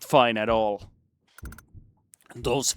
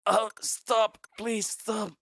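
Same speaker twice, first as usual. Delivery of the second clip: desperate pleading; 10 semitones higher, slower than usual. Acted. Begging bullies.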